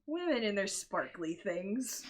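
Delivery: lightly voiced amused man, slow; a touch of a chuckling, dismissive man; half-laughing, mocking the girls; amused Theophilus. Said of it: "He's just like".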